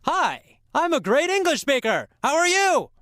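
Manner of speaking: high, excited public greeting; announcing to everyone. Shown as a demonstration. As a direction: call it out to the whole carriage. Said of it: "tell everybody on the train".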